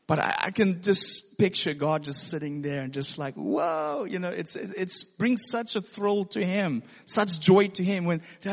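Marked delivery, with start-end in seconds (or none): 3.3-4.1 delighted whoa. Retold or imitated. God voiced delighted.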